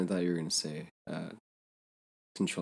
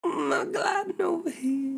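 high, slow playful call; sing-song moon voice; 3 dB louder, slower than usual. Pretend. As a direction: glide it out high.

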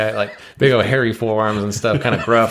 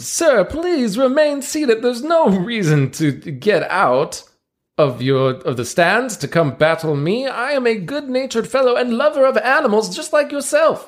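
higher pitched ringmaster patter, slow and grand; showman ringmaster; 11 semitones higher, slower than usual. Acted. Ringmaster presenting seals to the crowd, grand showman voice. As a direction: play the circus ringmaster working the crowd.

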